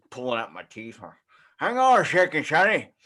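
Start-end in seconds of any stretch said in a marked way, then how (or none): 1.6-2.9 put-on a loud old codger mishearing Sonny; old man voice. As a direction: play a deaf old man on his podcast. Old man voice imitating himself aged.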